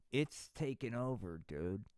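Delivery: ordinary talk, nothing marked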